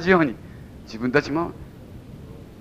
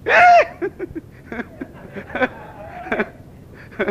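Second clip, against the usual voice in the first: a squealed, much higher "えー!" of mock dismay; mock shocked cry. Pastor mocks his own horror at apologizing, high squealed shock.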